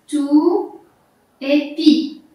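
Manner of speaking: "Tou" and "pi" as two isolated beats; syllables separated. Shown as a demonstration. Teacher showing two syllables, "Tou" and "pi" said apart. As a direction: pause between "Tou" and "pi". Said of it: "Deux syllabes".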